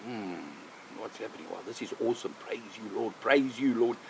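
very soft, low murmur to oneself; hushed awe. Acted. Man watching a buck approach, hushed awe. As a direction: quietly marvel and praise under your voice.

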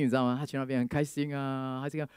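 usual speaking voice

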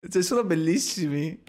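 breathy giggling speech; laughing through words. Real, not acted. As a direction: still cracking up. Still laughing at zombies.

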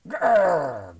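loud rolling growl; dinosaur growl. A narrator's reading. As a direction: growl back in refusal. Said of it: "growled the super hungry dinosaur".

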